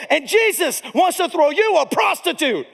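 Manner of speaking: high, building preacher's cry; fired-up pastor botching the climactic line; passionate preaching climax. Retold or imitated. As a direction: deliver the big line with full fire. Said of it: "it's time for me to say my line".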